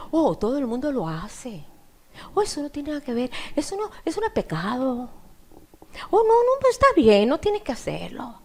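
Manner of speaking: soft, dismissive reassurance; coaxing tempter. Acted. The enemy talking believers into sin, soft coaxing. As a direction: softly brush the sin aside.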